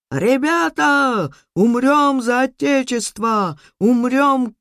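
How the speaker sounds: high, drawn-out battle cry; rallying shout. A narrator's reading. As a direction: call out to rally the troops. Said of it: "И кричит он нам".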